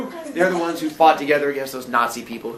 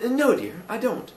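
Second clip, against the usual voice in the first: put-on higher-pitched landlady with English accent; 8 semitones higher than usual. Landlady answers Billy, put-on English accent. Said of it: "It's back now".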